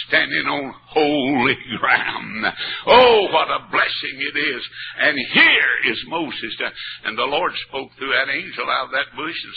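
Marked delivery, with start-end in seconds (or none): none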